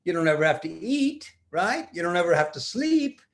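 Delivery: plain reading voice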